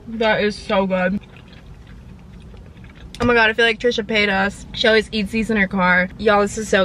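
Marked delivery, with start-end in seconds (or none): 0.1-1.2 slow savouring praise. Real, not acted. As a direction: melt over the taste, slowly. Savouring bite.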